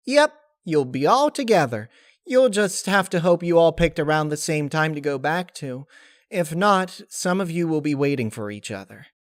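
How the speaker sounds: high, bright and matter-of-fact; high cheerful spirit voice; chirpy Laughter voice. Acted. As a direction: explain it sunnily.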